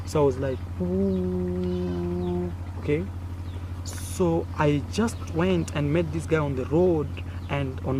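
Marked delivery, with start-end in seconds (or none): none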